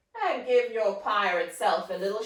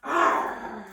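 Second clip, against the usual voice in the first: pirate growl; 4 dB louder than usual. Acted. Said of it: "I hope you sound really fierce".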